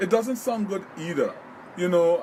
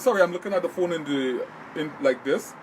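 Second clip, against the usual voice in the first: ordinary delivery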